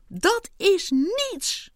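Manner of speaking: high, slow and drawn out; frantic insistence. A narrator's reading. Ant insisting while standing on his head, frantic. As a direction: stretch each word in a high cry.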